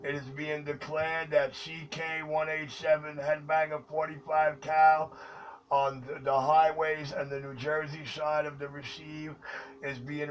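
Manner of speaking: loud, formal broadcast declaration; booming mock proclamation over the CB; radio announcement. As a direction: announce it to the whole channel like an official decree. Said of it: "Just for the record, Tri-State, anybody in the sound of my voice".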